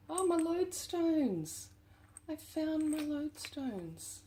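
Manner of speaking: light up, thrilled to find them; delighted squeal. Real, not acted. Thrilled, to viewers, on finding her lost lodestones.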